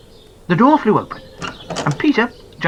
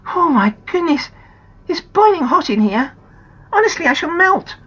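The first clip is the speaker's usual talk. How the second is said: much higher dramatic complaint; young girl; 13 semitones higher, 4 dB louder than usual.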